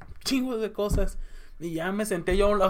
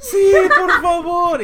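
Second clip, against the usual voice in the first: vocecita lastimera, aguda y lenta; llorosa y borracha; 9 semitones higher, 10 dB louder, slower than usual. Retold or imitated. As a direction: imita el sí lloriqueado de la borracha, más fuerte y alto. Imita a Sam borracha y llorosa. (Sam borracha acepta el pan, voz llorosa, aguda y lenta)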